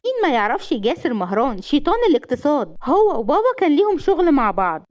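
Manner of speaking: high, eager gushing; enthusiastic. A narrator's reading. Rawan gushing about famous Jasser to his wife, high and eager. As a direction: gush with rising excitement. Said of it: "وقالت بحماس".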